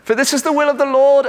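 high, quick mock-British reading; British accent. Inner voice reading God's will, in a British accent. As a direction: read scripture in a playful British voice. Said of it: "for some reason it's British".

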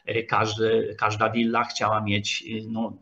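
usual speaking voice

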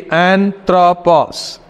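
"anthropos" slowly, syllable by syllable; broken into three. Shown as a demonstration. Teacher showing the class a word's three syllables, "anthropos" broken up slowly. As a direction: split "anthropos" into three parts, an-thro-pos. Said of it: "we are breaking into three".

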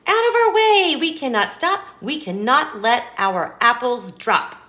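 high, loud urgent rush; urgent cry. Acted. Animals racing through with apples, calling out urgently. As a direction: hurry everyone out of the way.

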